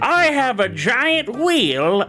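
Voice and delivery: high, showy carnival barker voice; circus ringleader. Acted. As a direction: hype up the crowd like a ringmaster. Circus ringleader sketch opening.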